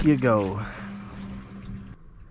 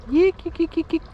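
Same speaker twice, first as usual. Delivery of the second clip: fish call; 3 dB louder than usual. Real, not acted. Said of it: "I forgot to call for 'em".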